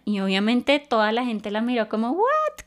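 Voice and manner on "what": "What" jumps very high in mock disbelief; a squeaky, incredulous "What?"; shocked what. The crowd staring at the girl, mock shocked "What?". Crowd reacting to bold question.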